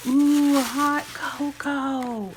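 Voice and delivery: high, loud ooh then slow drawn out words; real excited coo; delighted ooh. Real, not acted. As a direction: savour the treat with real delight. Opening gift package.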